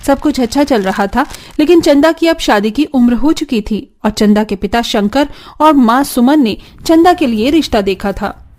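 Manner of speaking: plain reading voice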